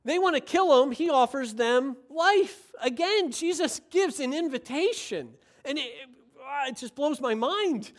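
all worked up. Real, not acted. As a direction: get carried away, pitch riding high. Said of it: "I'm getting all worked up right now".

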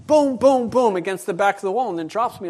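usual speaking voice

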